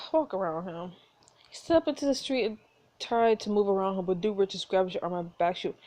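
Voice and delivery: usual speaking voice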